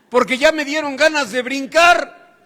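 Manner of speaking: high, loud punchline; comic outburst. Retold or imitated. Student begging off the therapy as rabbit urges start, comic outburst. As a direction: burst out in mock alarm.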